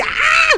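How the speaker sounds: high frightened scream; real startled scream. Real, not acted. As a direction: shriek as she grabs you. Caught by Granny.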